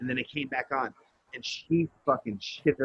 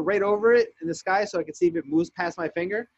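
usual speaking voice

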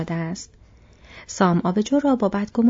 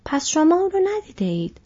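surprised; 9 semitones higher than usual. A narrator's reading. Farmer surprised strangers unaware.

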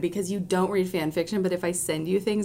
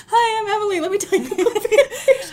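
high, laughing eager voice; imitating eager Emily; 12 semitones higher, 5 dB louder than usual. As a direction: play yourself overeager, laughing. Imitating Emily's first meeting.

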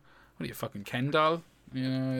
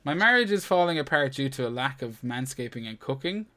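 normal talking voice

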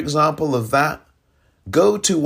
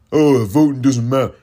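mock whiny voice, louder; mocking quote; 6 dB louder than usual. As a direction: sneer it like a know-it-all. Mocking those who say voting doesn't matter.

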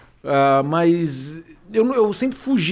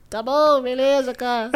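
put-on impression voice; 7 semitones higher, slower than usual. Impressionist giving in to a pushy fan, high put-on voice. Reluctantly obliging in a voice.

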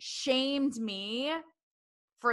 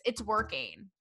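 normal talking voice

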